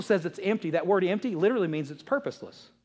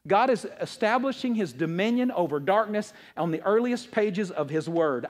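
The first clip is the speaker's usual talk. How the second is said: usual speaking voice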